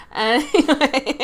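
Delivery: slow creaky fry; vocal fry. Pretend. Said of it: "See, vocal fry".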